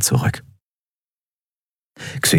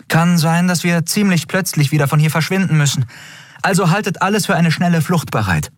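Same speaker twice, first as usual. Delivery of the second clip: put-on higher, quick, impatient young captain; Endriel, brisk captain; 13 semitones higher, faster than usual. A narrator's reading. Captain Endriel urges the crew to move on, higher and quick. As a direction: hurry them along, half joking.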